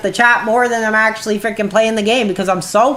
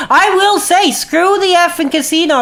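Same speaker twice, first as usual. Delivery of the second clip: emphatic outburst; 8 semitones higher than usual. Real, not acted. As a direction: blurt it out in a burst of anger. Repeats the phrase louder.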